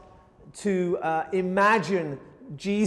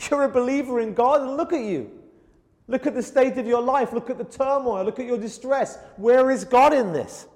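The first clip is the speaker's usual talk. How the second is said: higher, taunting jeer; mocking enemies; 5 semitones higher than usual. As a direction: sneer at the believer in his misery. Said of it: "his enemies would say to him".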